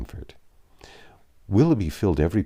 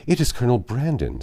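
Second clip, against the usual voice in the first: vexed; 5 semitones higher, 4 dB louder than usual. A narrator's reading. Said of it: "said she with vexation".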